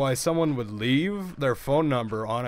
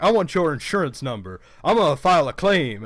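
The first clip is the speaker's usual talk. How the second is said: louder, higher mock demanding caller; imitated angry caller; 5 semitones higher, 6 dB louder than usual. Retelling the caller's insurance demand, imitating his angry voice. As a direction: act out an irate man making demands by phone. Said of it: "trying to reenact the gentleman's voice".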